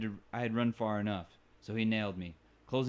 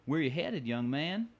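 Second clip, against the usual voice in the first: lightly voiced old Western bartender accent, a touch higher; a folksy bartender drawl on "Where you headed"; 6 semitones higher, 3 dB louder, slower than usual. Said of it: "You hear that accent".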